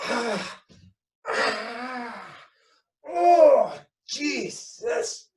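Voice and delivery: high, loud hammed-up agonised moans; mock groans of pain. City dweller acting out the kick to the groin, groaning in mock agony. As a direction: ham up a man doubled over after a kick.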